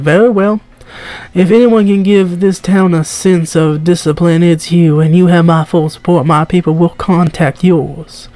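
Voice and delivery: attempted southern accent; [name]. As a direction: have a go at a genteel southern accent. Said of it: "I'm doing this southern, I'm trying".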